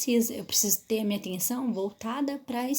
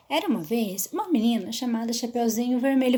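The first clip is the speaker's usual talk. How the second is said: storytelling voice; 4 dB louder than usual. Shown as a demonstration. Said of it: "Você não pode falar".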